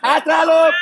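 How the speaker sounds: real mid-game shout; calling out. Real, not acted. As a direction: shout it out to teammates. Calling out during play.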